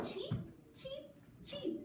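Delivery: put-on very high baby-bird chirps; short, high cheeps; little yellow chick. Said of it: "said the little chick yellow".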